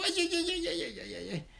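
high-pitched rapid yelps of pain; acted string of high pain cries. Heron gets a sharp belly pang, yelping. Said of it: "una punzada muy fuerte en su pancita".

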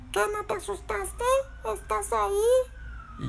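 squeaky, extremely high creature voice; very strange voice. Acted. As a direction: repeat it in a weird squeaky voice. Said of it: "muy extraña". (voz muy extraña y chillona)

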